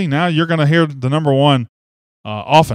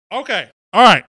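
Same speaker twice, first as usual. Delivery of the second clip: normal talking voice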